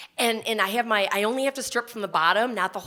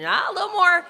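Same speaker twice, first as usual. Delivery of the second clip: high, louder coaxing nurse; 9 semitones higher, 6 dB louder, faster than usual. Retold or imitated. Nurse coaxing patient further down the table, pitched high. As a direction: urge her down a bit more, high and bright.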